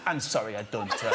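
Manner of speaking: put-on low, halting foreign accent; fake European accent. TV comic dodging a Greenpeace collector, fake European accent. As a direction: feign poor English in a generic European accent. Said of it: "and I did it again".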